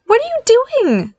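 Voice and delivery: high-pitched, indignant demand; startled demand. Acted. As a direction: demand it with a sharp jump up in pitch. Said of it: "Barry demanded".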